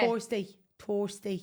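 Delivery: "Thursday" with a hard t, no th; Irish Thursday. Shown as a demonstration. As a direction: say "Thursday" as tursday. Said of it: "We don't say Thursday".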